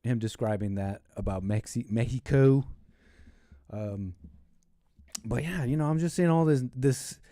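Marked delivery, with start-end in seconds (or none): 1.9-2.6 Mexico said as "MEH-hee-koh"; Spanish pronunciation. Real, not acted. Host correcting himself mid-word, Mexico said the Spanish way, "Mehico". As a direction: say Mexico with an h sound for the x.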